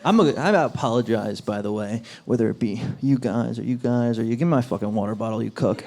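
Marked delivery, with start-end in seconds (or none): 4.3-5.8 mock aggressive. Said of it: "I'm teasing".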